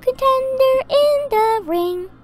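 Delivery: mock-sweet announcer gloating over bad luck; lilting high cartoon girl, teasing the player; Dee Dee impression. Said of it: "try and mimic her voice".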